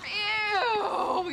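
loud, high, drawn-out ew; disgusted cry. Acted. Kat recalling wet toilet paper, loud disgusted ew. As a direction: shout ew in revulsion.

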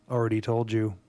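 normal talking voice

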